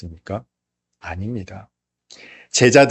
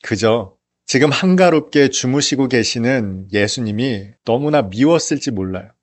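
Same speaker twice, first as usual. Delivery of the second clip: normal talking voice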